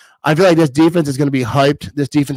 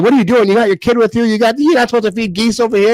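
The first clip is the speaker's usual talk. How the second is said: retold lady scolding him for feeding the geese; lecturing lady; 7 semitones higher, faster than usual. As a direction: tell him off like a stern stranger. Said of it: "lectured me".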